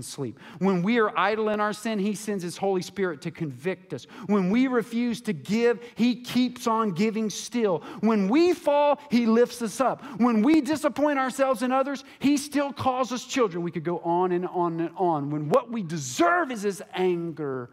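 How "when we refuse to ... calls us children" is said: building preaching. Real, not acted. Preacher rousing the congregation to a shouted climax. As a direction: climb higher and louder with each line.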